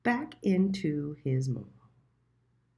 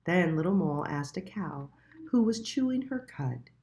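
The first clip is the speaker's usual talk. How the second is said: ordinary delivery, nothing marked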